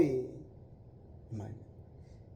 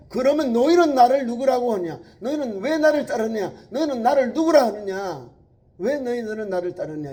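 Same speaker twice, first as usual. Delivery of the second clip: loud, high, searching questions; Jesus questioning disciples; 8 semitones higher, 12 dB louder than usual. Acted. Jesus pressing his disciples for their answer, loud and high. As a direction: demand an answer from them. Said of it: "제자들에게".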